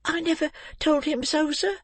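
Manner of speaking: put-on very high child's voice; amazed little boy. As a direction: protest in innocent amazement, pitched high. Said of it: "returned Oliver in amazement".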